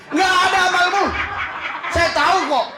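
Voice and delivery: playful shouted reproach; mock scolding. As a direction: scold the flock half in jest.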